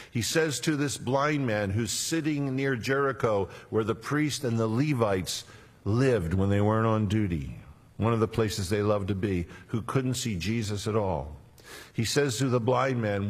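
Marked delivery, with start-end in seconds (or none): none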